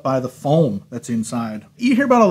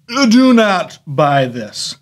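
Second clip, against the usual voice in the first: normal talking voice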